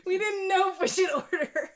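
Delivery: embarrassed, giggly admission; awkward confession. Acted. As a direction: squeeze it out sheepishly through giggles. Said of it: "just awkwardly be like".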